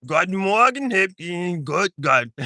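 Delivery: playful stab at German pronunciation; mock German, starting high; sounded-out German accent. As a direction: read it in a put-on German accent. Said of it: "that's what it look like".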